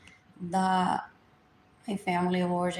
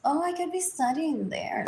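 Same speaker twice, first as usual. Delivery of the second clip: ordinary talk, nothing marked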